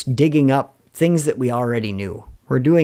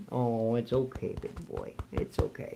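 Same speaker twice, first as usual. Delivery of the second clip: whispered reassurance; 4 semitones lower, 15 dB quieter than usual. Real, not acted. Owner whispering to his dog scared of thunder, soothing. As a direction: whisper softly, it's okay.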